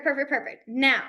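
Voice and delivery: ordinary talk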